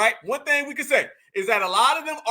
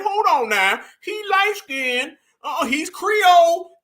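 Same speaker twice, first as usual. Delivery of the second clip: mock defender's voice; 7 semitones higher, 5 dB louder, slower than usual. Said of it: "there were people back then saying".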